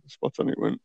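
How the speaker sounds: hushed aside to himself; whispered. Real, not acted. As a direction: breathe it out as a secret. Whispered aside after plan remark.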